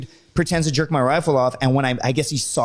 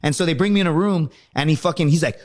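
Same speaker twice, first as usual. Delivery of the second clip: ordinary talk, nothing marked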